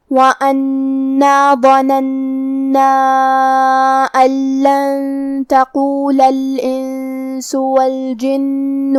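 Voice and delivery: slow, measured tajweed chant; Quran recitation. Shown as a demonstration. Teacher reciting the verse for a tajweed class, slow recitation. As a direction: recite with long held vowels and nasal hums.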